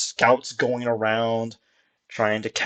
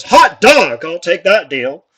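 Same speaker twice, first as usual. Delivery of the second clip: much higher, louder whoop, then a slow grin; gleeful hot dog, then a slow contented deal; delighted exclamation; 6 semitones higher, 10 dB louder, slower than usual. Acted. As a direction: jump at the free drink. Human accepts free drink.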